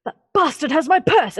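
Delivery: high, loud yelling guard; angry guard. Acted. Guard yelling that the thief has his purse. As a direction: shout it like a robbed guard hunting a thief.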